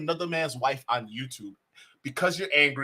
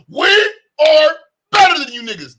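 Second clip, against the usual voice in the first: loud, much higher mocking yell of a boast; 11 semitones higher, 12 dB louder than usual. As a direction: ham up an arrogant brag, loud and high.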